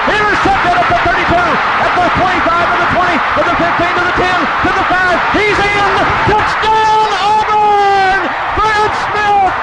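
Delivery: rising, laughing play-by-play; touchdown call. Real, not acted. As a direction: build with the runner to the end zone. Interception return called live.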